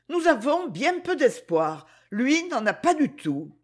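ordinary delivery, nothing marked

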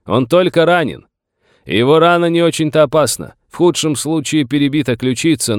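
high, carrying voice throughout; loud, insistent long-distance reasoning; shouted explanation. A narrator's reading. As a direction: call your case across to the other side. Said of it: "крикнул Челси".

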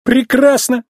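high, mock-delighted exclamation; scornful fake approval; sarcastic. A narrator's reading. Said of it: "Он фыркнул и саркастически добавил".

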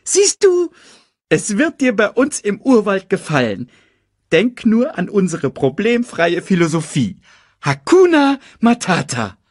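high, chirpy cartoon meerkat; perky sidekick selling his motto. Timon preaches motto.

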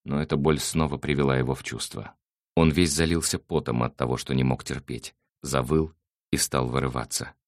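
ordinary delivery, nothing marked

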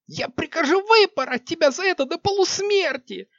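high screaming threat, stress on выпороть; furious shrieking threat. Acted. Queen threatening flogging.

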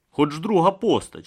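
ordinary delivery

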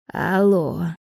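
feeble, bleated phone greeting; put-on elderly bleat; bleating old woman. A narrator's reading. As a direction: answer like a doddery old lady. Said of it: "старческим голосом проблеяла она в трубку".

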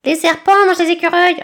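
put-on high, loud haughty young eagle voice; disdainful Aiglon. A narrator's reading. Eagle lecturing the others, high and disdainful. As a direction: sniff down the beak, showing off. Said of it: "d'un ton dédaigneux".

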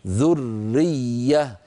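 very slow ذرية with the doubled r and doubled y clear; ذرية said very slowly, every letter given its right; both doubled letters shown. Shown as a demonstration. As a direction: give each doubled letter of ذرية its full length. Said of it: "نعطي كل حرف حقه".

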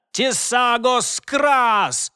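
slow, drawn-out spell shout. Acted. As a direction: bellow the incantation slowly. Casting a battle spell.

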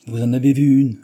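coupe la parole, plus fort et plus haut; interrompt. A narrator's reading. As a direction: interruption lancée avec force. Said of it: "interrompit le Japonais". (interruption plus forte et plus haute)

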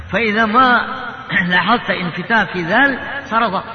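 ordinary delivery

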